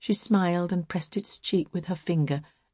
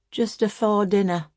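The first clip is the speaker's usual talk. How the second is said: dully; 4 semitones higher than usual. A narrator's reading. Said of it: "said Emma dully".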